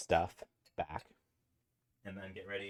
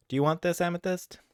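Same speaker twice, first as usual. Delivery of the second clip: pet talk; 12 semitones higher, 4 dB louder than usual. Real, not acted. Asking the cat sweetly.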